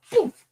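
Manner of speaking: high, short "poof"; imitated impact noise. Retelling a bump to the chest, imitated impact. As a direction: voice the bump as a sudden high puff.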